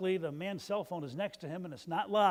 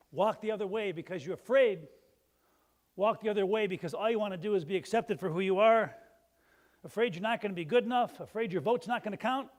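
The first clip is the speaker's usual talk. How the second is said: louder, rising preacher's call; fervent preaching; 3 semitones higher, 7 dB louder than usual. Real, not acted. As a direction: preach it hard to the pews. Preacher builds up to full force.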